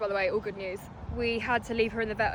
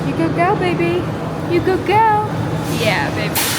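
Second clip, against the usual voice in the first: higher and louder, bright praise; puppy praise; 7 semitones higher, 8 dB louder than usual. Real, not acted. Owner encourages her nervous puppy at the store, higher and louder. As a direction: cheer the nervous dog on with praise.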